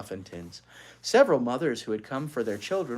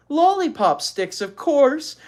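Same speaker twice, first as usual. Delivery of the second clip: delighted; 12 semitones higher, 8 dB louder, slower than usual. A narrator's reading. Beezus's delighted thought.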